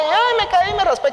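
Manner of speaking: put-on high whimpering kid voice; whiny child. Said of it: "uno llegaba corriendo con la mamá".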